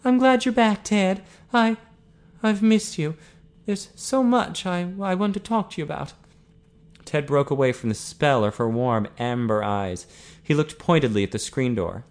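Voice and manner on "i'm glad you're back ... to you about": high, halting woman's confession; yearning Eileen. A narrator's reading. Eileen telling Ted she has missed him, yearning. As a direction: confide haltingly in a raised, tender pitch.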